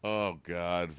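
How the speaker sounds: normal talking voice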